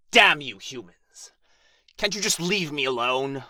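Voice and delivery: enraged dragon shouting at an intruder; furious roar. Acted. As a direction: bellow threats into the dark cave. Dragon yells at hidden intruder.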